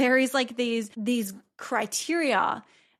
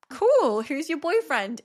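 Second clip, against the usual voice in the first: excited cool; 6 semitones higher than usual. Retold or imitated. Mum reacting to son's news.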